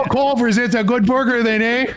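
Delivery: high sing-song Wisconsin voice; Wisconsin accent. Retold or imitated. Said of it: "We looked at us and be like".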